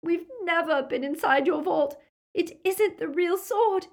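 high, distressed denial; frantic tearful protest; sobbed plea. A narrator's reading. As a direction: plead tearfully under torture. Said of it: "Hermione sobbed".

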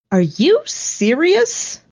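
high, slow disbelieving question; stretched-out, high-pitched incredulity; incredulous. A narrator's reading. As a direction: draw it out in rising disbelief. Drone's incredulous reply in story.

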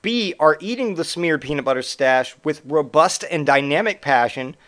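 ordinary talk, nothing marked

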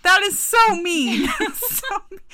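loud, high-pitched scolding through laughter; playful indignation. Real, not acted. Sister scolding her brother for the clown prank, playful indignation. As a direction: protest in laughing outrage.

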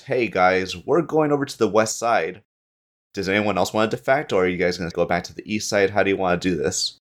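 ordinary talk

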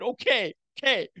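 high, meek quick okay. Retold or imitated. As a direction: give in to her. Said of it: "I said".

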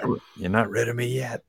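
breathy unvoiced whisper. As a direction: hiss it like a lurking threat. Teasing, spooky threat.

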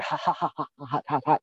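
imitating a forced nervous laugh; quick staged ha-ha laugh; mock Klugman laugh. Podcast host mimics Oscar's forced laugh after zipper line. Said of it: "Klugman's delivery where he's going".

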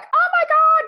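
high, loud mock shriek of panic; acted-out conniptions. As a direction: scream it as if having a hysterical fit. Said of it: "like, conniptions".

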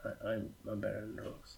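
muttered. Real, not acted. First quiet take.

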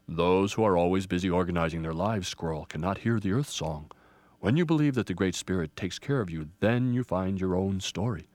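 low, measured elder voice; wise old Grandfather tree. Acted. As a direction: counsel with grave authority. Grandfather Ponderosa teaches.

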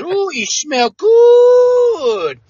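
very slow, high, crooning Cajun voice; Cajun accent. Retold or imitated. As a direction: stretch it out like a blissful Cajun. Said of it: "well, he was a Cajun".

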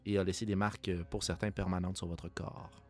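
game master narration. Acted. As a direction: set the scene, measured and grave. Game master sets the scene.

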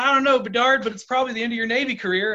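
high, heated chief's retort; angry threat. Retold or imitated. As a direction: imitate a worked-up chief, pitch way up.

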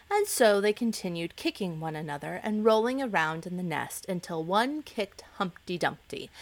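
plain reading voice

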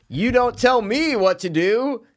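slow, high, loud acted defiance; hammed-up indignant declaration; mock defiance. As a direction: overact an indignant line, drawn out. Acts out a defiant line.

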